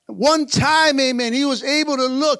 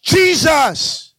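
higher, drawn-out cry of the name; demonstrated shout; 4 semitones higher, 4 dB louder than usual. Shown as a demonstration. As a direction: shout it out to lead the church.

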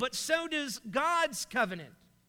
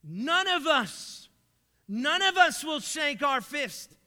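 preaching intensity; 2 semitones higher, 5 dB louder than usual. Real, not acted. Preacher builds to a climax.

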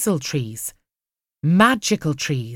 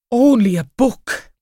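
a touch of relief in a high, louder voice; relieved; 5 semitones higher, 5 dB louder than usual. Acted. Post office man relieved it is only a book, lightly voiced. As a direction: let the relief out lightly on the words. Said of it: "clearly relieved".

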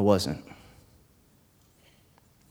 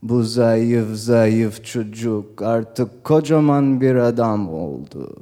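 father's voice; much slower than usual. Retold or imitated. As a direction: speak slowly as a proud father. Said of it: "He's talking on the telephone".

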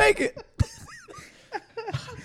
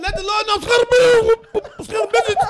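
loud, high mock-frightened shout through laughter; mock pleading; 9 semitones higher, 6 dB louder, faster than usual. Boy pleads with the preacher, a mock-terrified shout. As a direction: beg in hammed-up terror. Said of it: "The Lord gonna cleanse you".

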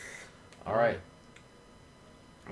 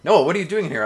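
startled; 7 semitones higher, 6 dB louder, faster than usual. Acted. Caught, startled.

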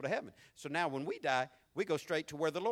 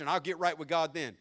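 ordinary talk

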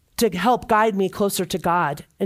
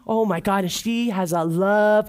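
ordinary talk, nothing marked

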